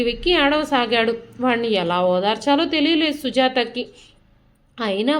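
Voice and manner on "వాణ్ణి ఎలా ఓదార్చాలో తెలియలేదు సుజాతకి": ordinary delivery, nothing marked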